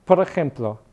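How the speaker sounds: ordinary talk, nothing marked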